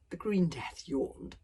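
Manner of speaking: ordinary delivery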